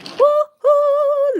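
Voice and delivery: high, loud celebratory woo-hoo; a happy high whoop of triumph; excited whoop. Real, not acted. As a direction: cheer like you just nailed it. Finishing a crochet stitch.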